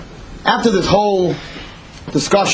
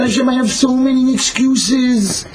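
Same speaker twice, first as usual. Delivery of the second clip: high, drawn-out pleading; an acted, slow plea; begging; 7 semitones higher, slower than usual. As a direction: beg with excuses, pitch raised. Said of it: "So he begged Hashem".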